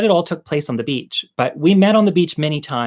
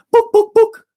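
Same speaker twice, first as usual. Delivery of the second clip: three loud high clucks, "Book, book, book"; chicken squawk; 9 dB louder than usual. Acted. Telling the joke, the chicken clucks three books, squawking. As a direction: squawk like a hen.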